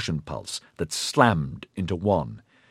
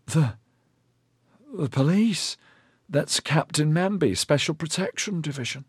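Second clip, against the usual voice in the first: shaken stammer; 7 semitones higher, 4 dB quieter than usual. A narrator's reading. Dazed Corilin stammers answer.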